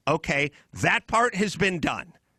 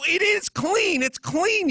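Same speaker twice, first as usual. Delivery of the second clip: much higher, emphatic retort; exasperated insistence; 11 semitones higher, slower than usual. Retold or imitated. Arguing with the others over rinsed plates, exasperated high insistence. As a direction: insist hard it's clean, exasperated. Retelling his argument with others.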